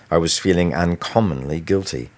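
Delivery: ordinary delivery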